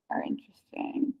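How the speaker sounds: breathy whisper. Real, not acted. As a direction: drop to a soft whisper. Voice drops to a whisper.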